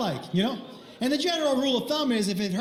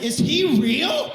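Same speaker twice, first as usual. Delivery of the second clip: slow, raised, drawn-out question; incredulous question; 4 semitones higher, 4 dB louder, slower than usual. Retold or imitated. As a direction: stretch it out in mock disbelief. Husband's absurd question, acted.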